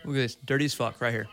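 normal talking voice

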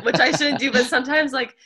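laughing while talking; words through laughter. Real, not acted. Laughing at own remark.

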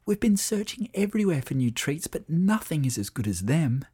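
put-on high, hushed child's whisper. A narrator's reading. Sam whispers to Lucy in bed in the dark, whispering. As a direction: whisper as children awake after bedtime. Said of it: "Sam whispered through the darkness".

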